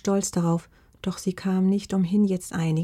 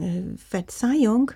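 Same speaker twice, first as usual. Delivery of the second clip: baffled grandma, pitch climbing through the question; falter, then rise in disbelief; higher, incredulous questioning; hesitant, startled stammer; 6 semitones higher than usual. A narrator's reading. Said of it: "stotterte Oma".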